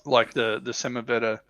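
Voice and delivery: ordinary delivery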